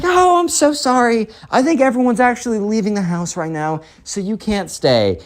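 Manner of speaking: high, sugary apology; super nice mom. Acted. Mom politely kicks out the kid, super nice. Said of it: "acting super nice while kicking him out".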